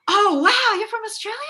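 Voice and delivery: high, loud gushing "Oh, wow"; mock amazed surprise. Actor mimics filmmakers impressed by Australian accent, high amazed gush. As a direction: imitate casting people gushing in amazement. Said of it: "they're still just like".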